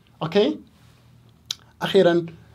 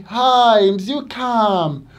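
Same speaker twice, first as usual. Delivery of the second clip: mock-excited boast, much higher and louder; loud, high imitation of proud students; 8 semitones higher, 8 dB louder, slower than usual. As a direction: play the students bragging proudly. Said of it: "they say".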